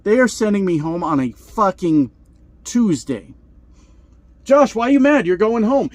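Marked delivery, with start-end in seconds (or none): 4.4-5.9 mock dispatcher. Mocking dispatcher asking why mad.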